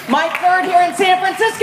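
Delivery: high, rousing call to the crowd; crowd-rousing shout. Real, not acted. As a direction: fire up the walkers. Rallying the walkers' crowd.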